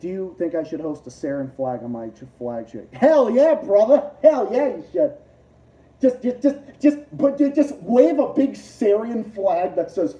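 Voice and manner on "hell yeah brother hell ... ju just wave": loud, high hype; hyped hell yeah. Real, not acted. Streamer answering a chatter, loud hype. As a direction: cheer him on, pumped.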